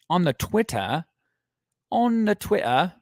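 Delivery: high-pitched hammed-up British accent; terrible British accent. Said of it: "probably a terrible impersonation to my U K listeners".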